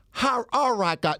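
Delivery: put-on high-pitched failed Chinese accent; botched Chinese accent. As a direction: attempt a Chinese accent and get it wrong. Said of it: "I was gonna do Chinese".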